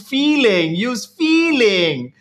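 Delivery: put-on Uncle Roger Chinese accent. Comedian Uncle Roger scolding cooks, in a slow mock Chinese accent. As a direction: drawl it out in a thick Chinese accent. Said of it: "he talks with a very thick Chinese accent".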